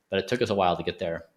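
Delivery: ordinary delivery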